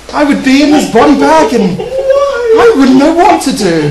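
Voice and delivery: fluttery posh lady; panicked prim lady; flustered lady voice. Pretend.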